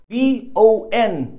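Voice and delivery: letters spelled out slowly; teacher dragging out each letter; slow spelling. Shown as a demonstration. Teacher spelling B O N slowly for students. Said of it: "تتدرب على الصوتيات".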